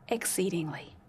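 "exceedingly" breathed as a soft whisper; whispered word. Acted. Preacher closing the verse for his congregation in a whisper. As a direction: let "exceedingly" fade into a whisper. Ending verse one sixty-seven.

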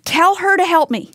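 lightly voiced Martha ordering Jesus; demanding. Pastor voicing Martha telling Jesus what to do, demanding. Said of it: "And then demanding".

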